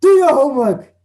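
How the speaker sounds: high, loud furious order; angry command. Shown as a demonstration. Angry teacher ordering learners to do homework. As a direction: bark the order in anger. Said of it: "if maybe I'm angry".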